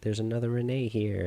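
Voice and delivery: high, gushing mock Billie Kay voice. Vlogger mimics Billie Kay praising the fake Canadian accent, high and gushing. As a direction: play up her fake amazement, pitched high. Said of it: "she's like".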